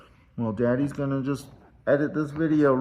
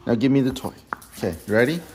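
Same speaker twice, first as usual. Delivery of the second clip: usual speaking voice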